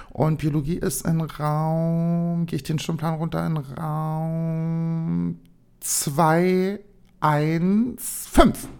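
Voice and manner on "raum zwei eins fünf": very slow, drawn-out zwei eins fünf; reading the number slowly. Shown as a demonstration. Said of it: "sag ich so, zwei eins fünf". (sehr langsam gedehnt: zwei eins fünf)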